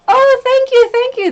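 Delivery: high, loud gushing thank you. Real, not acted. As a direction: gush with delight.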